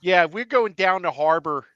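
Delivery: put-on light Baltimore accent, down the ocean hon style. Imitating Baltimore locals for friends, lightly. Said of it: "one thing that people in Baltimore say".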